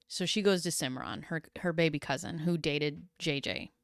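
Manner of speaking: ordinary delivery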